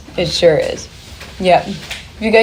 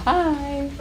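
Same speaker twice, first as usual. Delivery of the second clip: soft, higher-pitched little "hi"; loved one's soft greeting; 8 semitones higher, 7 dB quieter than usual. Acted. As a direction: say it softly and high, like a gentle hello from afar. Imagined greeting from departed loved one.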